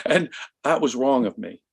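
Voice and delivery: ordinary talk, nothing marked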